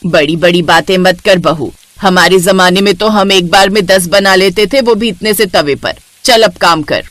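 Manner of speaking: low, bossy mother-in-law voice; a domineering saas giving orders; stern mother-in-law. Acted.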